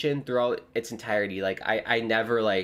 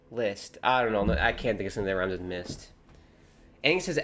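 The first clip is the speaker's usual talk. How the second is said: ordinary delivery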